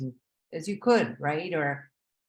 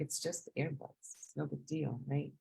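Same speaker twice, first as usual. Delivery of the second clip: quiet self-reassurance; 9 dB quieter than usual. Retold or imitated. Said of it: "I'm like".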